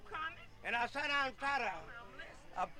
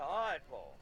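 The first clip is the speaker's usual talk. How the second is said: low meek reply; 12 semitones lower, 4 dB quieter than usual. Retold or imitated. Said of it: "I said".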